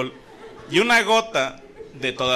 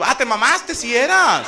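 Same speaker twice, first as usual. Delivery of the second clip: surprised; 7 semitones higher, 6 dB louder, slower than usual. Acted. Batman realizes, laughing.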